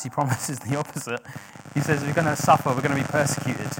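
ordinary talk

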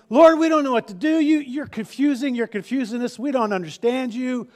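high, whiny complaint; whining people. Retold or imitated. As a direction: whine like a confused crowd. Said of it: "the people of God are whining".

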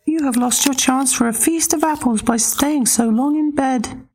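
very high teasing pig; cheeky little pig gloating. Acted.

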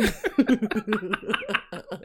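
long, soft run of giggles; helpless laughing. Shown as a demonstration. Friend who can't help laughing at cussing kids, helpless giggling. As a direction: show how you crack up, giggling on. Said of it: "I be laughing".

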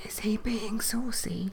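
slow, scandalised whisper; giggly hushed whisper. Acted. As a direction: whisper it behind his back. Wife whispers about the vicar.

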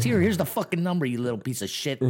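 high, loud sneering jab; mock boss taunt. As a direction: taunt him like the Boston boss. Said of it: "He goes".

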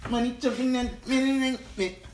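squeaky high-pitched silly take. Acted. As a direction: say it in a goofy high voice.

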